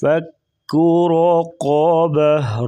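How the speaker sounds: slow, melodic tajweed chant; Quran recitation. Shown as a demonstration. Teacher reciting for students, slow melodic tajweed. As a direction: draw each word out slowly in recitation. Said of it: "را اور قاف کو پر پڑیں گے".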